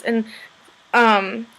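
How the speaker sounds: ordinary delivery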